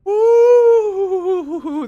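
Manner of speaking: long, high, loud "ooh" squeal; excited whoop. Real, not acted. Gamer learning he can have Borg crew, excited whoop. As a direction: whoop with delight, high and drawn out.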